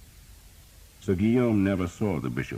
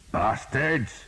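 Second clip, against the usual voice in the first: sharp high curse; furious shout; 9 semitones higher than usual. A narrator's reading. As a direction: yell it at the men who lamed his horse. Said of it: "he shouted at the men".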